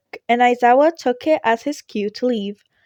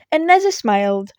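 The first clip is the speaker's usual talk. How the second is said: ordinary delivery, nothing marked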